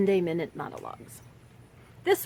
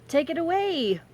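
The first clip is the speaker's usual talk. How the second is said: showy send-off; 9 semitones higher, 5 dB louder than usual. Real, not acted. Host cues the recorded monologues, showy send-off. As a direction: throw it over with a flourish.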